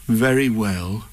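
slow, content Pooh voice. Acted. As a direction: agree slowly like Pooh.

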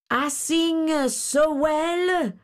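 high, drawn-out grasshopper voice; boastful grasshopper. A narrator's reading. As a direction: boast of your singing in a high, slow character voice. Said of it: "he said".

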